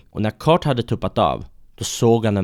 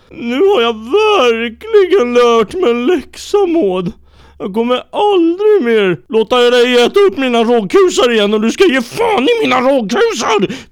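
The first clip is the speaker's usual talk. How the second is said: very high, loud crying voice; blubbering vow; 8 dB louder than usual. Acted. Kurt crying his promise to Maud, high and loud. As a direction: sob it out like a cartoon crybaby.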